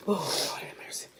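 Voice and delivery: breathless whispered plea; whispering. Real, not acted. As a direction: whisper it through the burn. Burning mouth, whispered plea.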